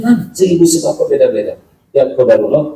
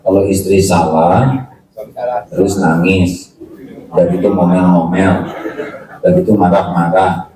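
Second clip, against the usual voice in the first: slow reading; 8 semitones lower than usual. Retold or imitated. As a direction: read slowly and low. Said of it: "tulisan dari seorang bapak-bapak". (membaca pelan dan rendah)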